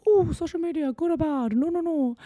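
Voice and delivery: high, put-on lecturing voice; mock speechmaker. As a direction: parody someone preaching from a high horse. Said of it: "giving out this big speech about".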